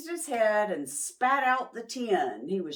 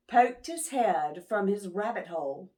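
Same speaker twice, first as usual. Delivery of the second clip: plain reading voice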